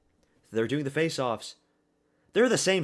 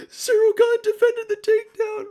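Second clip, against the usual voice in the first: squealing very high and loud on Gane; disbelieving shriek; 9 dB louder than usual. Real, not acted. Streamer shrieking the news that the takedown was stopped. Repeats it, far higher.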